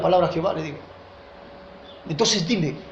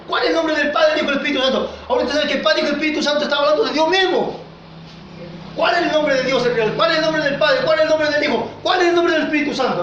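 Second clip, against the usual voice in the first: raised questioning sermon voice; rhetorical preaching questions; 5 semitones higher, faster than usual. Acted. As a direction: press the congregation with each question. (preguntas retóricas de predicador)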